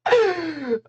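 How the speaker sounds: real high-pitched laughing fit. Real, not acted. Streamer losing it at a chat joke, high helpless laugh. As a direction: squeal with laughter.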